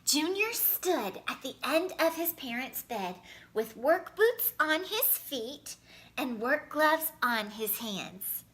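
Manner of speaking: ordinary delivery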